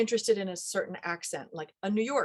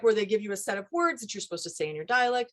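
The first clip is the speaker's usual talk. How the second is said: ordinary delivery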